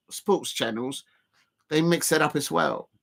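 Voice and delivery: usual speaking voice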